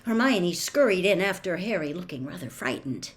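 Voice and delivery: plain reading voice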